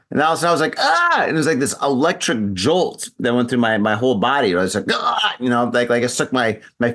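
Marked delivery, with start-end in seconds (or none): 0.8-1.2 high imitated yelp of shock; jolted yelp. Recalling a jolt through the body, shocked yelp. Retells feeling an electric jolt. 4.9-5.4 high-pitched mock cry of shock; jolted yelp. Finger in a light socket, shocked yelp. Retells feeling an electric jolt.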